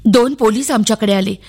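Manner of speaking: ordinary delivery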